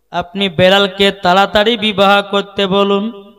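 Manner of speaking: loud, insistent sermon delivery; projected preaching. Acted. Preacher repeating the plea to the Prophet, loud preaching. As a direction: send the plea out at full voice.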